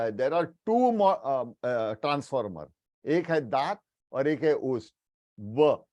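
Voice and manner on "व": व said with the upper teeth on the lower lip; touch the upper teeth to the lower lip for व; the right way to say व; correct व. Shown as a demonstration. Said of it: "it is not वो".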